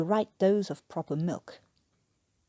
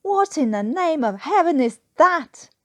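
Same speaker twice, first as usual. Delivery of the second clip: higher and loud, incredulous; appalled outcry; 9 semitones higher, 8 dB louder than usual. Acted. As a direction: demand it in disbelief. Newkirk appalled by the tea.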